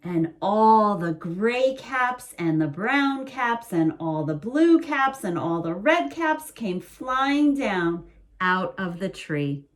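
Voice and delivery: ordinary delivery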